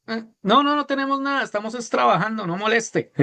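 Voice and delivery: high, annoyed brush-off; tired fishermen snapping back; grumpy fishermen. Acted. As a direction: wave off the stranger, irritated. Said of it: "Y los discípulos".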